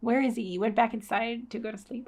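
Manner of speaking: ordinary talk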